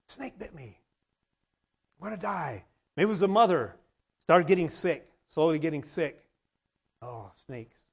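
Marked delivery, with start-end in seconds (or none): 0.1-2.6 much softer, hushed; a scared little confession; frightened child. Retold or imitated. Bitten child telling mama, hushed and frightened.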